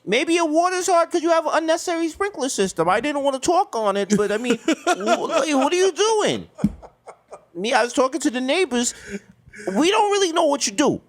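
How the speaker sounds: high, nasal-free suburban dad voice; nerdy neighbor voice. Pretend. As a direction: play the prim neighbor. Roleplays suburban neighbor Doctor Feinberg.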